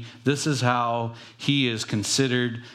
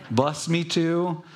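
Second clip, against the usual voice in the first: put-on high, slow childish whine with a laugh; mock a whiny little kid; 7 semitones higher, slower than usual. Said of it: "Sounds like little kids".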